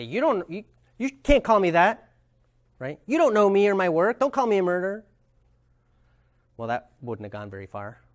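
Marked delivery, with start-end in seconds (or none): 0.0-5.0 raised, louder protest; defensive. Retold or imitated. Marshall imagined answering defensively, raised protest. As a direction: push back hard as if insulted. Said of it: "He could have gotten defensive".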